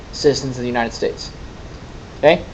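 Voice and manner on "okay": ordinary talk, nothing marked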